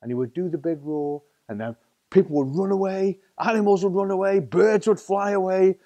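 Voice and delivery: ordinary delivery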